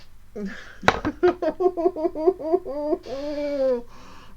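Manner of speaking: long, high, falling laugh; wail-like laughter in defeat; high despairing laugh. Real, not acted. Player reacting to losing, high despairing laughter.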